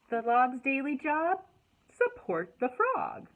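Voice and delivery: slow, loud storytime reading; expressive picture-book read-aloud; reading to children. Acted. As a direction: read each line out slowly to the kids.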